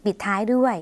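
ordinary delivery

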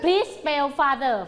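slow, spaced-out classroom command; slow clear instruction. Shown as a demonstration. Teacher giving children an instruction, slow and spaced. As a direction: lay each word out for young learners. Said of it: "คือให้สะกดคำว่า father".

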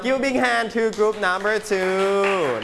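stretched, rising-energy call for applause; announcer-like cheer. Real, not acted. Teacher calling for applause for group two, rousing drawn-out cheer. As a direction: whip up applause, dragging out the last word.

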